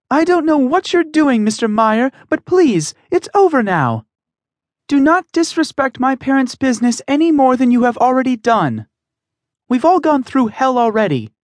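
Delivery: a daughter standing up to a bully, high and strained; young woman pleading. Acted. Emmy confronts Mister Meyer, firm high pleading voice.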